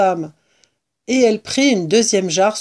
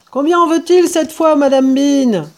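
high, loud holler; yelling; 8 semitones higher, 7 dB louder than usual. A narrator's reading. Huge woman shouts up to the mistress, yelling. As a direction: holler it up the cellar stairs. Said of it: "hurla la femme".

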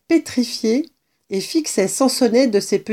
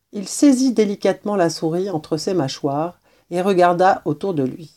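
plain reading voice

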